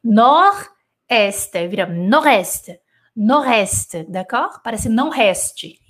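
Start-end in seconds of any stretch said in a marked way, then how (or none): 0.0-4.0 "Nordeste" said as nor-este, with no D; silent D. Shown as a demonstration. A teacher drilling nord-est for beginners, D silent. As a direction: repeat "Nordeste" without the D. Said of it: "esse D aqui não existe".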